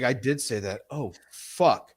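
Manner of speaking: quiet, low imitated admission; hushed retold confession; dawning realization. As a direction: say it softly as guilt sinks in. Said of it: "a come to Jesus moment".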